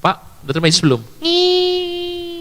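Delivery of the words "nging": high nasal-free whine of dismissal; high dismissive hum; mock whining hum. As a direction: hum it high to wave someone off. Imitated brush-off noise.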